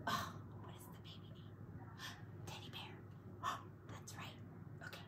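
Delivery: fast excited whisper; hushed gasping whisper. Real, not acted. Whispering to child at bedtime.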